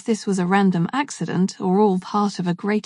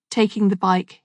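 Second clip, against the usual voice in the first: ordinary delivery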